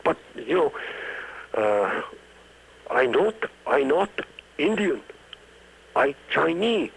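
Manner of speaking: high-pitched mock Chinese accent, broken English. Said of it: "pretend that they were Chinese".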